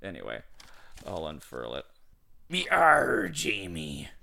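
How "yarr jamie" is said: loud rising pirate Yarr, then Jamie dropped back down; pirate accent. Acted. Reading a pirate's letter aloud to Jamie, loud pirate accent. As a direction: belt Yarr like a pirate hailing a ship, rising at the end. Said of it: "it's a pirate accent".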